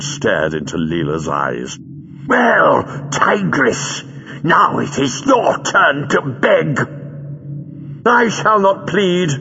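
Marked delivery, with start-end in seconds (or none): 2.3-6.8 high-pitched, drawn-out taunt; gloating villain. A narrator's reading. Villain staring into trapped captive's eyes, gloating. 8.1-9.3 high, slow, proud refusal; scornful. A narrator's reading. Captive woman answering her gloating captor, scornful. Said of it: "said Leela scornfully".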